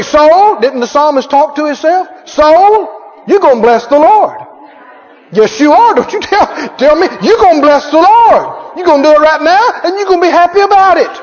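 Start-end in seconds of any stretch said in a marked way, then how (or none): 0.0-0.6 higher, louder call to the soul. Acted. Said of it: "To say". 2.3-11.1 commanding the soul. Acted. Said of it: "To say".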